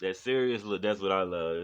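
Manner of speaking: usual speaking voice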